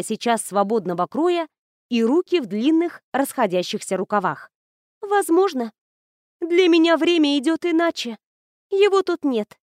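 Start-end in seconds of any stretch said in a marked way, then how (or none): 5.0-9.6 high, distant ghost voice; wistful ghost bride. Acted. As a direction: sound far away and timeless.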